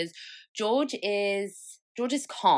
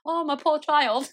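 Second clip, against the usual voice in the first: mock pity; 9 semitones higher, 5 dB louder than usual. Mock pity for her child.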